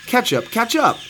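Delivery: high-pitched call across a distance. A narrator's reading. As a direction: call out high to someone lagging. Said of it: "called the biggest monkey".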